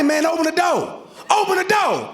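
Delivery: high-pitched, insistent mock yell; acted yelling. Locked-out husband demanding his wife open up, acted yelling. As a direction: holler through a locked door. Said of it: "While I'm yelling".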